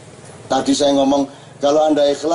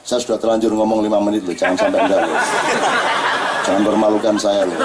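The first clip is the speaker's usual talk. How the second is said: doa dalam hati; 3 semitones lower than usual. Retold or imitated. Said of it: "dalam hati saya gini ngomongnya".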